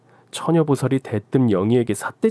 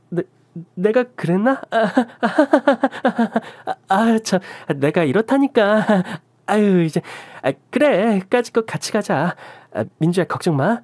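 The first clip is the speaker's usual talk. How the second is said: higher, stammering cover-up, laughing it off; flustered friend; 9 semitones higher than usual. Acted. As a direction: bluff your way out, a bit too eager. Younghee caught lying, covers up.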